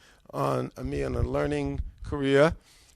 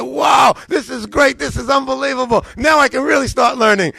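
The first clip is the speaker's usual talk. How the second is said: high, loud, exuberant exclaiming; thrilled outburst; 8 semitones higher, 8 dB louder than usual. Retold or imitated. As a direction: burst out as if striking gold. Thrilled by list of suppliers.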